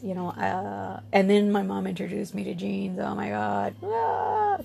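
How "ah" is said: high, held "ah" of revelation; blissful "ah". Acted. Recalling her first pair of jeans, acted delighted "ah". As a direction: sing out a high sigh of delight.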